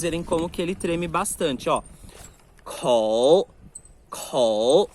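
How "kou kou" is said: Kou com forte sopro de ar, baixo e alto; aspirated kou. Shown as a demonstration. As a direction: sopre ar forte no Kou, fazendo o papel tremer. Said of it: "Tem que sair bastante ar". (Kou bem aspirado, forte)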